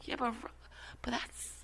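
hushed whisper. Real, not acted. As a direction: drop to a whisper. Quick hushed objection.

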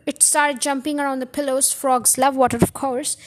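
ordinary delivery

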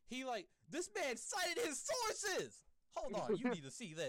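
high, slow excited exclaim. Real, not acted. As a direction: sell the amazing discovery. Excited reveal.

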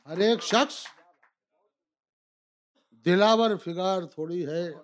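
rising, declaimed couplet; poetry recitation. Acted. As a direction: declaim it to a live audience.

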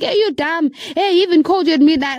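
high, quickening mock yell; retold shouting. Wife accusing the wrong woman, retold shouting. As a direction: imitate an angry caller, pitched high.

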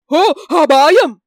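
slow, loud cry of danger; alarmed cry. Acted. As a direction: call out the warning, drawn out. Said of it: "என்று ஒரு குரலை கிளப்பினான்".

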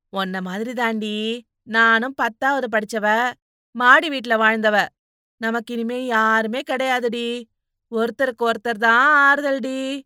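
impassioned sisterly comforting; consoling young woman. Acted.

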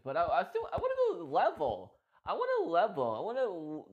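bubbling over with wanting to go level; eager excitement. Real, not acted.

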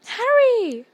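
high, loud cry of greeting; bursting out in delighted relief; excited cry. A narrator's reading. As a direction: call the name out high and loud. Hermione calls out on seeing Harry.

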